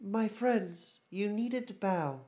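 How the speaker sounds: lightly voiced empress, pitched high; a hint of high-pitched royalty; regal empress. Empress addresses the bowing party.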